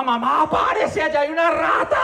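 high, rising panicked call to his mother; mock scared shout, pitched high; calling out to mom. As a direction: yell for mom to get up. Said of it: "yo gritándole a mi mamá".

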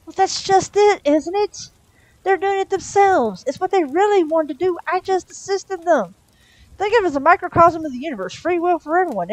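high, pushed pitch, stretched endings; defensive justification. Acted. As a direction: plead your case high and insistent. Demon defensively justifies the shooting.

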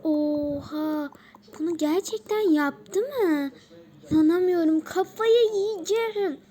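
loud shock building to a high, drawn-out outburst; hammed-up freak-out; outraged disbelief. Acted shock at news of kiss.

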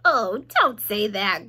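slow, dismayed groan in a piglet voice. A narrator's reading. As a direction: groan it out slowly. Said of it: "groaned Wilbur".

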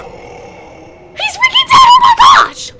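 high, loud scream of disbelief; anguished scream. Real, not acted. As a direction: scream it out as he realizes the character died. Character dies on screen.

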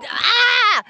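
a high, thrilled cry; a squeal of real delight; excited yelp. Real, not acted. Prospector squeals over a nugget, excited.

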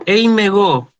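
"eimego" said as one single word; run as one word. Shown as a demonstration. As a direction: glide through "eimego" without the iota. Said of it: "como si fuera todo una, una sola palabra".

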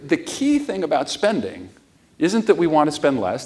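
ordinary talk, nothing marked

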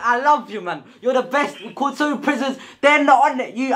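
ordinary talk, nothing marked

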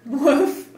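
loud playful woof; dog woof. Acted. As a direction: bark like a puppy. Dog sound for toddler.

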